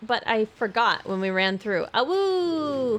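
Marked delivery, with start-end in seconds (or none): 1.9-3.0 high playful awoo; wolf howl. Pretend. Wolf playing game howls.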